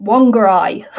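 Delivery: "Wongarai" starting with w, ending like eye; local Kiwi pronunciation. Shown as a demonstration. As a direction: round the "Wong" and end "Wongarai" on eye. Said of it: "local Kiwis, um, call it".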